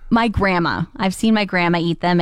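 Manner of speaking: ordinary talk, nothing marked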